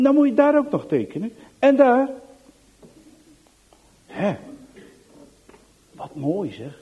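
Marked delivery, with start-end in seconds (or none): 0.0-2.1 put-on quick, eager little boy pointing out spots; eager child. Young Johan pointing at Anneke's drawing, eager. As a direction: point it out, all keen.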